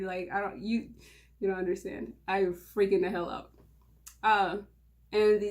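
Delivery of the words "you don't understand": ordinary talk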